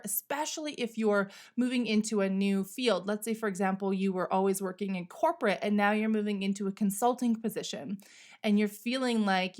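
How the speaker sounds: normal talking voice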